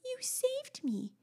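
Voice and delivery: put-on high, slow, breathy-thanks child voice; grateful little girl. Mother imitating daughter thanking her rescuer. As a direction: gush thanks like a rescued toddler.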